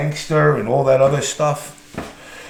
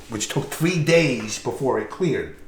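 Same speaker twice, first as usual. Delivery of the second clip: normal talking voice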